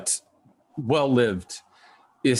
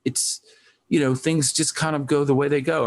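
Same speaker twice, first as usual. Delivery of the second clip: put-on light New Zealand accent, a bit higher; 6 semitones higher than usual. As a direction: quote him in a mild New Zealand accent. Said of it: "has a New Zealand accent".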